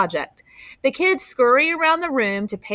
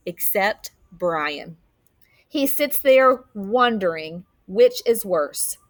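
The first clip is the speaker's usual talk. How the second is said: ordinary delivery, nothing marked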